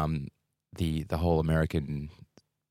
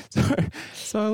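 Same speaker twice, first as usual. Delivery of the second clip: laughing. Retold or imitated. Laughing in the Dutch voice.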